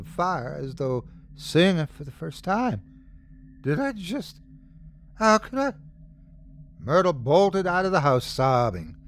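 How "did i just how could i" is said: horrified realisation. A narrator's reading. Mother realises what she did.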